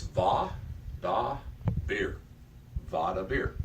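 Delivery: "va, da, bir" as three separate syllables; broken into syllables. Shown as a demonstration. Teacher showing students the syllable breaks, pronouncing carefully. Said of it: "break the word up into two syllables".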